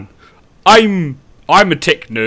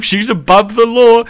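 mock adoration; 9 semitones higher, 6 dB louder, slower than usual. Mocking Clinton's special treatment.

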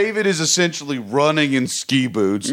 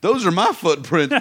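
ordinary talk, nothing marked

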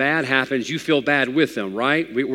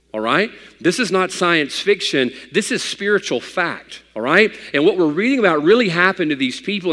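higher, louder preaching; preaching at full force; 5 semitones higher, 3 dB louder than usual. Acted. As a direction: press the point home to the congregation. Preacher drives home the point.

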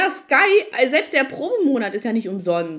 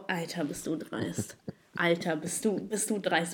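muttered disbelief; 5 semitones lower, 9 dB quieter than usual. Retold or imitated. She silently fumes at the support agent, softly muttering. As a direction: mutter it under your breath. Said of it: "Und dann war ich so".

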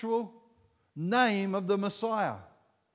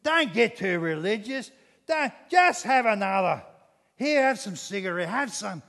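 lightly louder mocking banter; mates jeering; 2 semitones higher, 5 dB louder than usual. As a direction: pub mates scoffing, pushing another beer. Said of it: "They shouted even louder at me".

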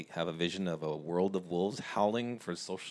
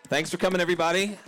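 excited thanks; 8 semitones higher, 5 dB louder than usual. Real, not acted. Host thanks after the howl.

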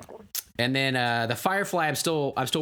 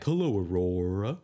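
slow lower lion greeting; 5 semitones lower than usual. Acted. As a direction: lower and slower, like a toy lion. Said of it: "he'll say, like".

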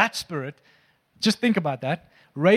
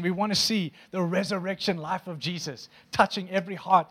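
usual speaking voice

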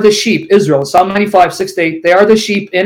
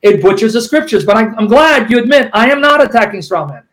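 usual speaking voice

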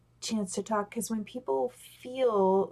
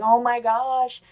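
mock excited gush, louder; imitating herself getting carried away, louder; 3 semitones higher, 7 dB louder, slower than usual. Speaker showing how she gets over-excited, a mock excited gush. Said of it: "my pitch goes up".

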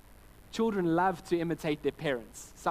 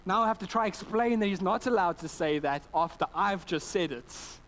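normal talking voice

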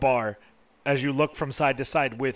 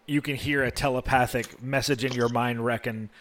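ordinary talk, nothing marked

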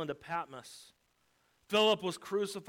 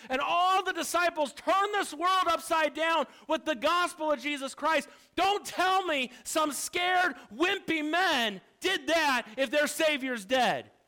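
raised, driving pulpit voice; impassioned preaching; 7 semitones higher, 5 dB louder than usual. Real, not acted. Preacher insisting to the congregation that the Savior lives, impassioned. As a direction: preach it hard, building to an emphatic finish.

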